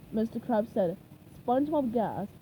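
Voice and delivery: ordinary delivery, nothing marked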